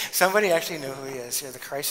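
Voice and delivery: ordinary talk, nothing marked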